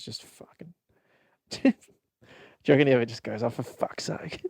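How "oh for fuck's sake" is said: slow, breathy muttered groan; exasperated sigh. Retold or imitated. Tired dad groaning at his son's antics. Dad imagined sighing at son.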